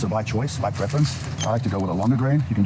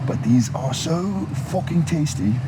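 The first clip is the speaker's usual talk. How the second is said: slipping into a higher British accent; emphatic, raised-pitch British accent; 7 semitones higher, slower than usual. Real, not acted. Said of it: "switched to a British accent there".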